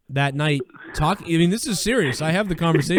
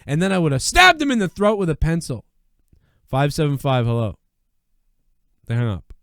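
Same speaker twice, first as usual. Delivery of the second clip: ordinary talk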